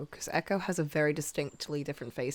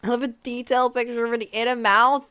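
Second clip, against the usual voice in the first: excited; 7 semitones higher, 8 dB louder than usual. Real, not acted. Gushing over drawing reference.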